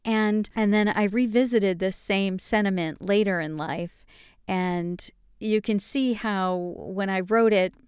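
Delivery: ordinary talk, nothing marked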